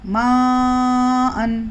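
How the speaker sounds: ماءً with the aa drawn out for four to five counts; long madd recitation. Shown as a demonstration. Quran teacher models the madd in ماءً for learners, stretched slowly. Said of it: "تو یہاں پر ہم کو چار سے پانچ حرکت کھینچنا ہے".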